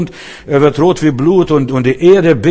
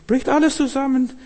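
much higher, ringing out on alles; emphatic repeat; 12 semitones higher, 3 dB quieter than usual. Acted. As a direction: lift the repeat up high to hammer it home. Preacher repeats the collapse for emphasis. (hoch betont wiederholt, alles hervorgehoben)

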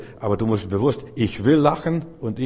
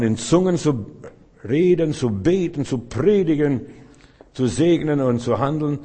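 rising preaching fervor; 4 semitones higher, 4 dB louder than usual. Real, not acted. Preaching builds to a climax.

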